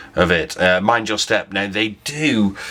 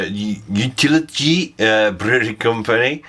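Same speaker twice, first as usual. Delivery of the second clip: Utility with a Dutch lilt, rising at the end; Utility said like a Dutchman speaking English; mock Dutch accent; 2 semitones higher than usual. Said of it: "went a bit Steve McLaren there".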